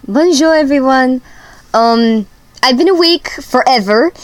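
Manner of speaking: put-on French-accented hello. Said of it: "I'm sorry for my accent".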